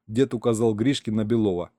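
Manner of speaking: ordinary delivery, nothing marked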